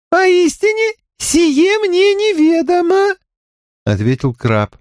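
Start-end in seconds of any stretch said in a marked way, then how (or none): 0.1-3.2 very high monotone alien voice; high, flat and mechanical, like a crab creature. Acted.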